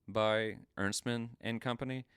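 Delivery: ordinary talk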